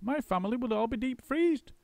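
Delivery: high, chattering monkey; a monkey's quick squeaky voice; Muggle Wump. A narrator's reading. Muggle Wump questioning the roly-poly bird.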